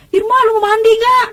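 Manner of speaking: high-pitched imitation of mother calling; mother's yell. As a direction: call out high like mom, without full volume. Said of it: "Kata emak saya udah teriak-teriak". (teriakan emak, nada tinggi)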